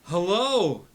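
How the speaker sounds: high, booming dad on a bad line; loud excited dad. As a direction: shout into the stone as an eager dad. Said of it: "it's Dad".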